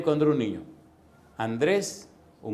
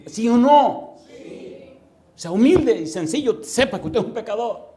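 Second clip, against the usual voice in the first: loud, raised preaching voice; forceful exhortation; 7 semitones higher, 6 dB louder than usual. Real, not acted. Preacher urging listeners to admit sin, loud and forceful. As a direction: press hard for a confession, pitched high. Preacher demands admission from congregation.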